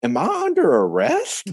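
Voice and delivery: high, worried question, played for laughs; mock alarm. Caller asking the warrant clerk, high mock worry. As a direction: ask it in a high mock-anxious voice. Retelling scared call to warrant office.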